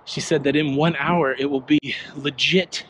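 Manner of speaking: usual speaking voice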